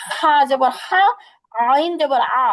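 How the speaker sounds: Arabic ḥa and ʿayn pressed; pressed throat sounds. Shown as a demonstration. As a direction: tighten the throat for হা and আ. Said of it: "কণ্ঠটাকে চাপাইয়া উচ্চারণ করতে হবে".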